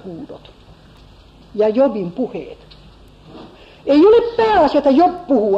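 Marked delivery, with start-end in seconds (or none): 3.9-5.6 thought voiced high, with a lift on ole; a touch of a self-important comforter; his inner voice set noticeably higher; Eliphaz's proud thought. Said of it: "ajattelee Elifas".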